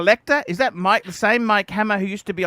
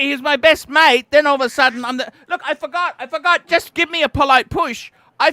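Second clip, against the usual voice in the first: higher and louder, hurt and indignant; worked up; 8 semitones higher, 5 dB louder than usual. Real, not acted. Host defends forgetting the review to co-host, worked up and louder. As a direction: vent it, pitched up with a half laugh.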